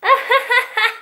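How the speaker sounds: bursts into a high laugh; a sudden high giggle. Real, not acted. Amused by the brush spinning.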